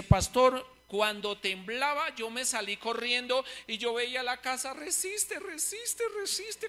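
high, hushed, desperate urging; frightened pleading. Retold or imitated. Brother reliving the earthquake, begging his house to stand, high hushed plea. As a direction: beg the house to hold, pitched high and soft.

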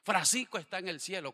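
lightly hammed-up graveside preaching; mock funeral eulogy. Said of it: "pararme donde está un cajón y decirle". (pastor imitando un sermón fúnebre, algo más alto y fuerte)

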